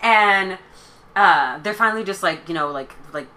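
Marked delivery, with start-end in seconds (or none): none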